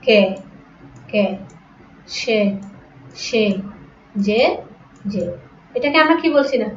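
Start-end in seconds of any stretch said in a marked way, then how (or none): 0.0-5.2 কে, সে, যে with plain closed "e", one by one; সংবৃত উচ্চারণ. Shown as a demonstration. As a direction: say কে, সে, যে separately, vowel unstretched. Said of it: "উচ্চারণ করার সময়". (কে, সে, যে আলাদা করে, এ-কারের মতো)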